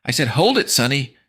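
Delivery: high, firm command; stern mom. Acted. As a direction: order it like a strict coach.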